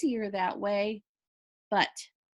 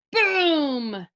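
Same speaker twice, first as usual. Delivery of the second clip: booming sound effect; 3 semitones higher, 6 dB louder than usual. A narrator's reading.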